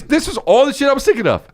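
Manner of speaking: normal talking voice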